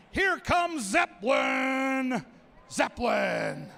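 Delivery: slow drawn-out name call; announcer hyping the crowd for each corgi; ring announcer intro. Acted. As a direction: stretch the name like a boxing intro.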